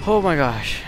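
loud gasping "oh my gosh"; startled outburst. Real, not acted. Player startled by a noise behind him, loud outburst. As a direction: blurt it out after a jump scare. Jump scare in game.

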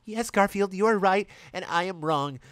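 put-on high, submissive girlish voice; a hammed-up meek apology. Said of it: "apologized Tally".

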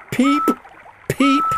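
high, loud spluttered peep; spluttering whistle. A narrator's reading. Soaked Peter Sam whistling for help, spluttering high peeps. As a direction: sputter out a soggy whistle. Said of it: "he spluttered".

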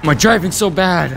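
loud, high-pitched complaint at yourself; exasperated. Real, not acted. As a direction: wail in frustration at your own mistake. Exasperated at own bad driving.

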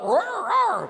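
high, loud comic roaring; a sister's high, loud hammed-up roar at the dog; mock roar. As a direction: roar like an angry kid.

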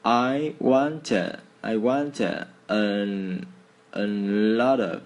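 slow, halting, pausing between words. Shown as a demonstration. Teacher breaking up the sentence for learners. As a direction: say it piece by piece. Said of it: "Nghe lại từng chậm một từ nhá".

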